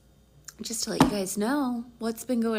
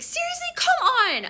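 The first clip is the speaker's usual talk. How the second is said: high, loud exasperated outburst; retold annoyance, voice shooting up; annoyed; 6 dB louder than usual. Woman to stalling boyfriend on the phone, annoyed. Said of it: "I started getting annoyed".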